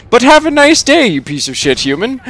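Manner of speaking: high, chirpy farewell; mock-cheery sign-off. A narrator's reading. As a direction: feign politeness before an insult. AI signs off with a wink.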